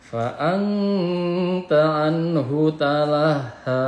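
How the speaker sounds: very slow tartil with long holds; Quran recitation. Shown as a demonstration. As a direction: chant it, drawing out nasals and vowels.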